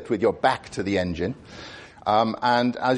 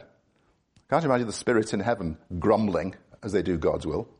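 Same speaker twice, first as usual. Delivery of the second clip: ordinary talk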